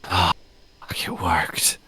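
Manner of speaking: hoarse, whispered rasp; raspy whisper. Acted. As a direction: rasp it out under your breath. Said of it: "you hear a raspy voice go".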